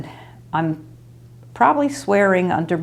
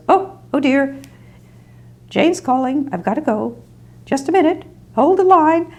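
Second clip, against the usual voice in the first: high, flustered, rushing voice; flustered old lady; 9 semitones higher than usual. Retold or imitated. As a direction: imitate a frazzled elderly woman hurrying off the phone. Imitating Kitty flustered on phone.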